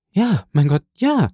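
a high cry of horror on the last ja; horrified. A narrator's reading. Valentin answers the count, horrified. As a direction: break into a frightened cry.

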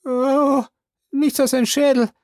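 high, loud servant voice calling down; Jupiter's voice. Acted. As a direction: pitch him well up and call out. Jupiter reports skull.

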